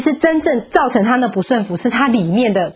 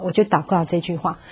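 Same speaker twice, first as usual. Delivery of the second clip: ordinary talk, nothing marked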